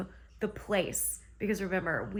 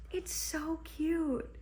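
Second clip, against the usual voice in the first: high and slow, melting over how cute it is; gushing; 8 semitones higher, 3 dB quieter, much slower than usual. Real, not acted. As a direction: coo it higher, dragging every word. Gushing over a sweet scene in the book.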